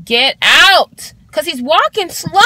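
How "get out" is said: loud shouted order; angry shout; yelling. Retold or imitated. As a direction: bark him out the door. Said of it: "like, yelling".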